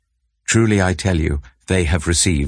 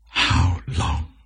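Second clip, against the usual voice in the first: whispered plea. Acted. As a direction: breathe "How long?" as a faint, voiceless whisper. Psalm lament, repeated plea.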